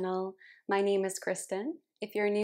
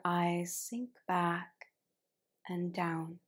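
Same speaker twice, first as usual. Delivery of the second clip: unhurried meditation cueing, letting each phrase settle; guided relaxation. Real, not acted. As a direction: guide them gently into rest, phrase by phrase. Guides relaxation at the start of yin yoga.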